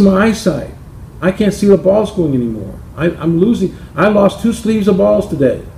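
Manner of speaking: a hint of a fed-up golfer; complaining [name].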